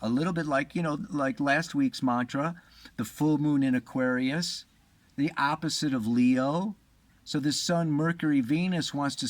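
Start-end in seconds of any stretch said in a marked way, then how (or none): none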